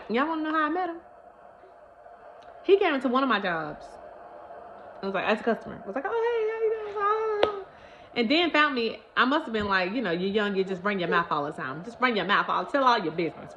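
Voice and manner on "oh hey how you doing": very high, slow sugary greeting; slow high chirpy hello; sweet naive greeting. Retold or imitated. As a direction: play the naive girl. Imitating her younger self.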